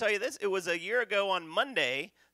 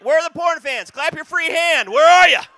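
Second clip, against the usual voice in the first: shouting to the crowd; 13 semitones higher, 18 dB louder than usual. Real, not acted. Comic polls porn fans.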